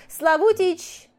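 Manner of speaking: shouting a name across the shop; loud call. A narrator's reading. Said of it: "громко крикнула женщина".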